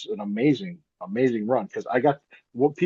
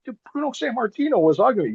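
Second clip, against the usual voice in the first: much higher, thrilled retelling; excited; 13 semitones higher than usual. Retold or imitated. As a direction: squeal it out in disbelief. Telling dad, thrilled.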